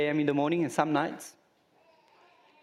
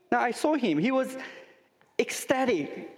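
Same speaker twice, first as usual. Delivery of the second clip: much higher, eager telling; excited; 8 semitones higher than usual. Real, not acted. Preacher describing the ecstatic Olympic father, high and excited. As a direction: let the joy lift the voice.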